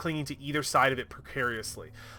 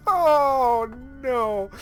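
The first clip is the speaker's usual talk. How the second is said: higher, laughing shout; 9 semitones higher, 3 dB louder than usual. Real, not acted. Player laughing at the twist, loud laughing oh no. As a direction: laugh it out in mock dismay.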